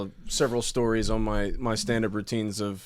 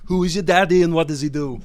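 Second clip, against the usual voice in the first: loud Schwarzenegger-style Austrian voice; Arnold impression; 7 semitones higher, 9 dB louder than usual. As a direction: demand it like Arnold. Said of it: "Can I do Arnold?".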